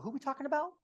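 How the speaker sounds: very high-pitched mock busybody; imitated gossip. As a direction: pitch it way up as a nosy quilter. Retold gossiping quilting women's question.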